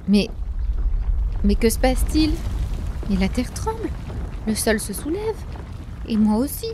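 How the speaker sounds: voix haute et affolée; paniqué. A narrator's reading. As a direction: panique soudaine, plus fort. The mountain suddenly lifts. (affolé)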